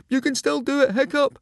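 very high, loud holler; high, urgent cheering-on; encouraging shout. A narrator's reading. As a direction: shout support to a friend who is losing. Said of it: "he shouted".

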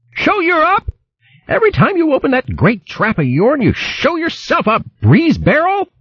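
high, scolding country woman voice; shrill hedgehog wife scolding. Acted. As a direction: berate him like a fierce old hog wife. Merklewort scolds Baron.

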